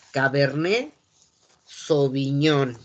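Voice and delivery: "Cabernet" without T, "Sauvignon" drawn out; French pronunciation. Shown as a demonstration. Teacher modelling the grape name, slow French "Cabernet Sauvignon". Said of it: "Entonces es cabernet".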